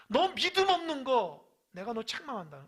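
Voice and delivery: high, loud scolding as God; God scolding the faithless, loud and high; God's rebuke. Acted. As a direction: thunder down a rebuke on the doubter.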